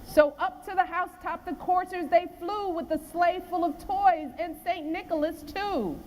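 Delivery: measured storybook reading of the poem; poem read to children. A narrator's reading.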